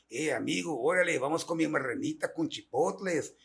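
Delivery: imitated Mexican Spanish; Mexican accent. Imitating a Mexican inviting a friend to eat, Mexican accent. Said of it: "Já no México".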